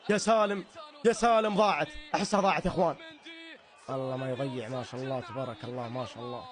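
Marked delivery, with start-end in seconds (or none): 3.9-6.4 hushed prayer. Real, not acted. Hushed prayer before the penalty.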